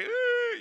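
high squeaky ooh of pain; a small child's high ooh; pained whimper. Retold or imitated. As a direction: yelp like a hurting toddler. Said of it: "And I'm like".

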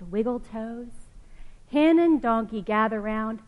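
lively storybook reading voice; reading to children. Acted. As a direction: read it like a picture book for kids. Reading picture book aloud.

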